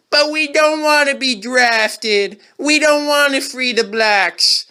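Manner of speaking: slow, very high, loud mocking whine; mock complaining. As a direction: imitate whiny complainers, drawn out and high. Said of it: "They were complaining".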